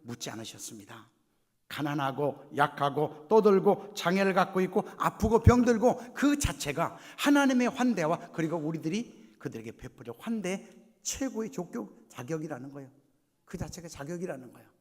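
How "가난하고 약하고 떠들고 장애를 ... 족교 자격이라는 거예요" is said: slow, loud, rising intensity; emphatic preaching. Acted. Preacher naming who deserves welcome, slow and forceful. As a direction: drive each item home at full preaching force.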